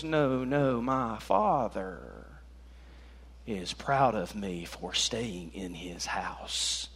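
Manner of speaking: slow, low, hushed reply; calm, quiet correction. Acted. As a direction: answer the scolding softly and very slowly. Boy Jesus calmly corrects his parent.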